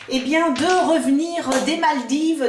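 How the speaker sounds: ordinary talk, nothing marked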